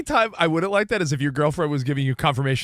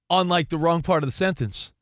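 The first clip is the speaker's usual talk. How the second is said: ordinary talk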